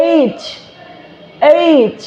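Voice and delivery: "এইচ" with a clearly released final ch; careful, isolated "এইচ" with full ch ending; very clear articulation. Shown as a demonstration. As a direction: finish "এইচ" on a crisp, audible চ. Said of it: "একদম clearly বলতে হবে".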